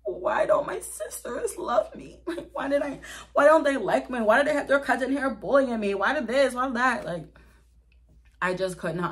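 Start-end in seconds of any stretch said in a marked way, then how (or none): 0.0-7.0 high, whiny mock sobbing with laughs; hammed-up crying self, half laughing; imitating her younger self wailing to her sisters; mock bawling. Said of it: "bawling my eyes out".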